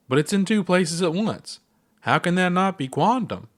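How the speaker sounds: high, eager insistence; earnest reasoning. Acted. Ponder arguing with Gabbro about the seed, high and eager. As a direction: argue the point with excitement. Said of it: "Ponder reasoned".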